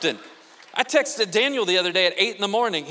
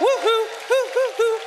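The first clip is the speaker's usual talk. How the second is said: high, loud celebratory whoops; cheering woo-hoo; 7 dB louder than usual. Pretend. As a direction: whoop it up to rouse the crowd. Cheering to rouse the congregation.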